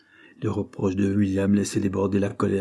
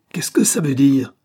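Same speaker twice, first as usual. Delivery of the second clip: higher, much louder furious demand; angry cry; 5 semitones higher, 7 dB louder than usual. A narrator's reading. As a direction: snap at him in outrage. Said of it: "s'écria Cyril".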